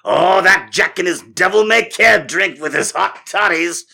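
put-on loud, high old-timey scolding, words drawn out; outraged townsfolk. Townsfolk gossiping about the hot toddy drinker, outraged shouting. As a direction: cry out like a scandalized crowd. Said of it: "Everyone's like".

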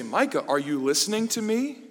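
mock-irritated mom calling out; imitating scolding mom. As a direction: demand the child's attention like an irritated mother.

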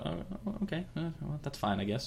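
tentative and quiet, letting it go awkwardly; hesitant acceptance. Retold or imitated. Reinhardt reluctantly accepts a refusal.

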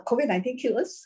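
normal talking voice

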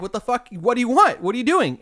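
high-pitched, exasperated questioning; retold alarmed challenge. Worker to man hitting the glass, retold alarmed challenge.

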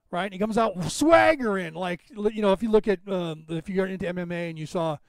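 ordinary talk, nothing marked

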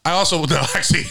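high, laughing backtrack. Real, not acted. About naming the restaurant, laughing. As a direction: giggle and take it back.

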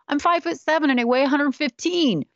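defensive explaining. Retold or imitated. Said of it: "I started explaining myself".

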